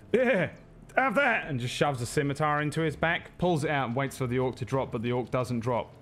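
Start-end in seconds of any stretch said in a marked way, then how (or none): 0.1-1.5 [name]. Acted. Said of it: "and just says".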